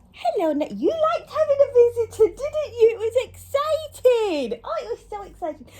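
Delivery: high, sing-song voice for the dog. Real, not acted. Talking to her dog.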